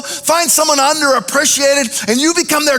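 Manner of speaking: loud, high, rhythmic preaching cry; rally the congregation, voice ringing; full-force preaching. Acted. Pastor urging the church to brag on others, preaching full out.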